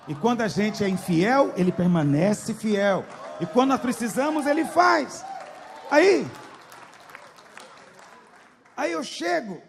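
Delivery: loud, rising preacher's cry; fervent shout. Real, not acted. Preacher shouting to the church about Christ's faithfulness. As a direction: build to a ringing shout of faith.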